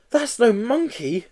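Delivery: high, slow, know-it-all lady; lollipop lady voice. Acted. Lollipop lady correcting the seller, slow high prim voice. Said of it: "said the lollipop lady".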